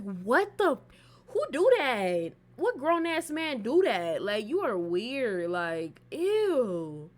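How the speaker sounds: loud, drawn-out appalled outburst; loud, slow and grossed out; disgusted disbelief. Retold or imitated. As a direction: stretch each word in loud, scandalized disgust. Retells her disgusted reaction to nudes.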